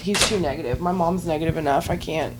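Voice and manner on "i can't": normal talking voice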